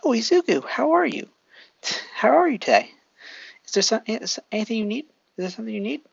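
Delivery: a hint of a doting mother greeting a guest; Jules' mom. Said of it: "so Jules' mom says".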